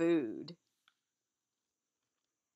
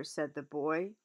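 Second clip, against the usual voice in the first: ordinary delivery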